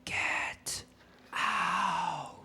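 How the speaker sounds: spooky breathy ghost voice; ghost whisper. Pretend. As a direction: hiss it like a haunting ghost. Playing ghost haunting house.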